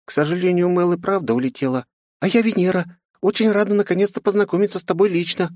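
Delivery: put-on high, light child's voice; little girl voice. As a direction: speak as a small girl. Little girl Venera introduces herself.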